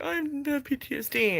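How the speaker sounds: high-pitched, softish mock whimper; mimicking your own tearful whining; mock crying. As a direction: put on a whiny cry, making fun of yourself. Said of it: "like, crying on here".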